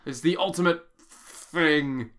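slow, grand announcer voice; slow dramatic announcer. Pretend. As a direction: announce it slowly with mock grandeur. Mocking the dub's phrase.

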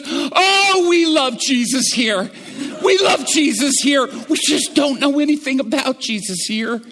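high, loud, choked-up exclamation; tearful cry. Retold or imitated. Shop owner grabbing my hand, tearful and loud. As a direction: cry it out with tears welling. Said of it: "tears well up in his eyes".

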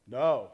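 NO pitched low at normal volume. Shown as a demonstration. Pastor showing tones of no, low-pitched NO. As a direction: drop the pitch on NO. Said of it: "tone and inflection you say no".

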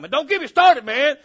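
sudden loud, high outburst; mock worked-up. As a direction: erupt like someone too excited to calm down. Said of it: "trying to get myself calmed down".

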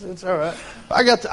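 ordinary talk

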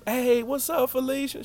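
put-on high, light voice for the sweet neighbor kid; Mari's voice. Mari calling hi to Felicia, high and sweet. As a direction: greet her in a high sing-song voice.

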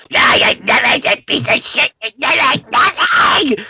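high, loud New Yorker tirade, building to a shout; father's shouting tirade. Retold or imitated. Old father in a wheelchair berating his son, a high, loud New York shout. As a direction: scream down at your son from the wheelchair. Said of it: "his father being in a wheelchair just going".

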